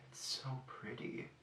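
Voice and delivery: hushed, voiceless whisper; admiring whisper. Real, not acted. Vlogger admiring a new eyeshadow shade, whispered. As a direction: whisper it softly to yourself in admiration.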